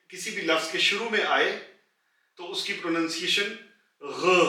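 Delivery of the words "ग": ordinary talk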